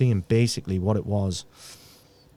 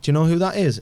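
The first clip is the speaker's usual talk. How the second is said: higher, louder coaxing question; sister prompting mum; 8 semitones higher, 6 dB louder, faster than usual. Retold or imitated. Sister coaxes mum at care home, higher and louder. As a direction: coax a confused mother to recognise her son.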